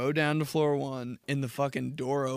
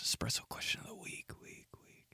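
faint breathy echo fading out; whispered echo; 13 dB quieter than usual. Shown as a demonstration. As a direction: whisper it like a reverb tail. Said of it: "whispered echo effect".

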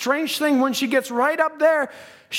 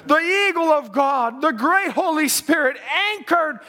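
full-force preaching; 6 semitones higher than usual. Acted. Preacher to his church about the eagle, full-force preaching. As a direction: preach it out, voice soaring.

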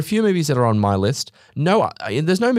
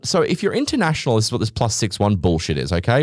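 ordinary delivery

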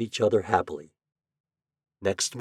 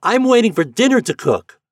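very high, loud piping reply; high-pitched child calling back; piping boy's voice; 9 dB louder than usual. A narrator's reading. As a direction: pipe up like a small boy calling out. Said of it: "answered in his piping voice".

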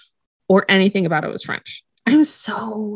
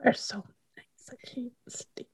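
real tearful near-whisper, very soft; 3 semitones higher, 18 dB quieter than usual. Real, not acted. Co-host moved by listeners' kind messages, tearful. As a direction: barely get it out, moved to tears. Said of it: "You made [name] cry".

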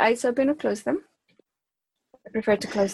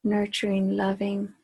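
measured meditation voice with long pauses; unhurried, evenly paced meditation leading; guided meditation. Acted. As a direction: guide the group inward, phrase by phrase. Leads a group meditation.

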